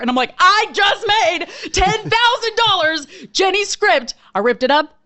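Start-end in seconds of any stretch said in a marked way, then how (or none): 0.4-4.1 slow, high and gleeful; triumphant glee. Retold or imitated. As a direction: savour the win, giddy. Triumphant after second sale.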